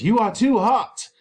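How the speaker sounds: wife telling Capulet he is too hot. Acted. Lady Capulet intervenes.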